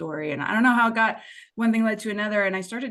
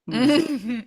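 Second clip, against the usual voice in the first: higher, louder teasing challenge; playful defiance; 6 semitones higher, 6 dB louder than usual. Real, not acted. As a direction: dare it to prove you wrong. Defiant joke about lasting change.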